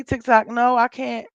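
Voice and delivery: normal talking voice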